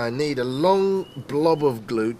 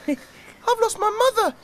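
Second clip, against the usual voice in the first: high, fast chick voice; tiny squeaky chick; cheeping chick. Acted. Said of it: "cheep little chick".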